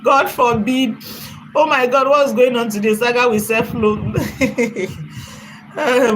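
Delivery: usual speaking voice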